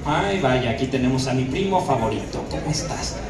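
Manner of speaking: mock your cousin with contempt; rude Félix. Shown as a demonstration. Félix greeting his cousin Adrian, low and sneering. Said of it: "mucho más grosero".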